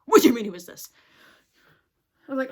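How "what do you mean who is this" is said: exasperated. Retold or imitated. Exasperated at boss not knowing her.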